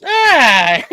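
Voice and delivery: loud laughing yell; Michelangelo's scream. Retold or imitated. Dad retelling the ride, screaming like Michelangelo in Ninja Turtles. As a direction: belt out a cartoon turtle's scream. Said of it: "where Michelangelo says".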